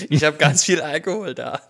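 gleeful, giggling brag; laughing with glee. Real, not acted. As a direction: laugh through the boast. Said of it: "Wie er sich freut".

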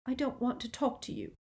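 soft, high, tight-throated; constricted voice. A narrator's reading. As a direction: squeeze it out softly, holding back tears. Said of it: "in a constricted voice".

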